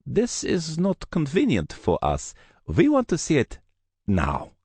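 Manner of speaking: Russian thug demanding entry; cold insistence. Acted. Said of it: "The humorless smile returned".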